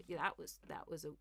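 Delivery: ordinary talk, nothing marked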